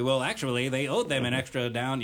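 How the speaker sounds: know-it-all nerd voice. Pretend. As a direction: correct them like a pedantic nerd. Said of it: "Is that your pocket voice?".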